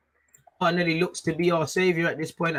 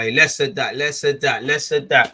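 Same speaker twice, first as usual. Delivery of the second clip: telling off; 3 semitones lower, 6 dB louder than usual. Real, not acted. Streamer scolding the chat.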